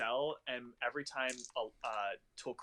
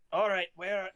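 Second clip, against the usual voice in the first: put-on Australian accent; 7 semitones higher, 4 dB louder than usual. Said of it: "going back to my Australian accent".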